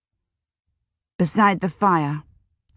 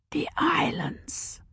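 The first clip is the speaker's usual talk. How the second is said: slow, soft, high whisper; breathy child's whisper; 9 semitones higher, 8 dB quieter, slower than usual. A narrator's reading. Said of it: "Thoreau whispered".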